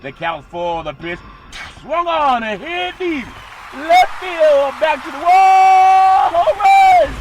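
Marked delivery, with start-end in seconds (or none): 1.8-7.1 building play-by-play, rising to a shouted higher call; announcer calling a homer to the wall, louder each phrase; home run call. Acted. Sportscaster to the crowd, building to a roaring home run call.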